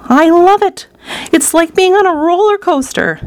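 put-on high, thrilled boy's voice; excited shout. A narrator's reading. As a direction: cry out with roller-coaster glee. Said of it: "Charlie shouted".

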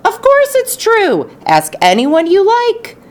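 put-on eager high exclamation of old people; excited cry. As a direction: fling it out, thrilled old folks. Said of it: "cried all four of the old people".

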